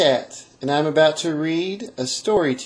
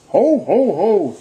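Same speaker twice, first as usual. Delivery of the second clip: Santa ho ho ho; 3 semitones higher, slower than usual. Acted. Santa's jolly laugh outside.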